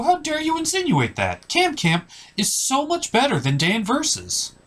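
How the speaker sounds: hammed-up offended squeal; mock outrage. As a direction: pitch shoots way up in indignation. Host outraged at comparison.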